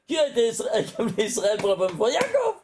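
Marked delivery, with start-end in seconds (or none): 2.1-2.6 high excited burst on the name; triumphant answer. Real, not acted. Teacher revealing his riddle's answer, high excited cry.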